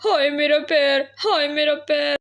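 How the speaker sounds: milk the fake injury; fake pain moans. A narrator's reading. Sparrow keeps faking foot pain for the neighbour, hammed-up moaning. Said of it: "चिल्लाने लगती है".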